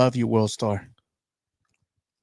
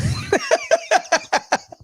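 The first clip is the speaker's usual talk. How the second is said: high-pitched laugh. Real, not acted. Laugh after joking about aliens.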